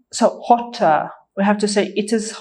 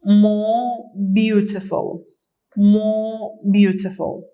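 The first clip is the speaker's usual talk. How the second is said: more beautiful said slowly, more stressed; deliberate teacher drill pace; slow model answer; slower than usual. Shown as a demonstration. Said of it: "What we say is more".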